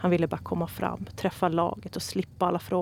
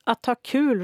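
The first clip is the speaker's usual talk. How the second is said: loud, high group cheer; team shouting together; 6 semitones higher, 7 dB louder, slower than usual. A narrator's reading. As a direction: call it out as one team. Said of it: "ropade alla".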